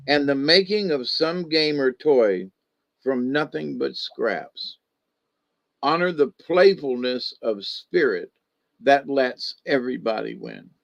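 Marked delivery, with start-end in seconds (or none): none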